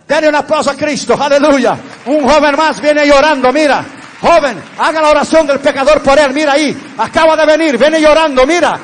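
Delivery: loud, high revival shouting; full-force exultant Pentecostal preacher; fervent preaching. Real, not acted. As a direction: cry out in triumph to the whole church.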